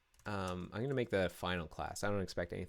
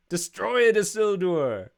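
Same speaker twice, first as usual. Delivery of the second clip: high, loud gleeful announcement; playful triumph; 9 semitones higher, 9 dB louder than usual. Real, not acted. Coder gloating to viewers that destroy works, high and loud. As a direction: crow it like a victory. Celebrating working code.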